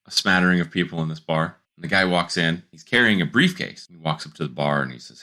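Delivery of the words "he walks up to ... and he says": ordinary delivery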